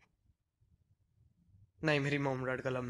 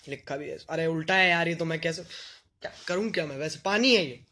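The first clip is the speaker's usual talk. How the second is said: ordinary talk, nothing marked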